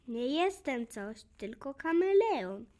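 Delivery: usual speaking voice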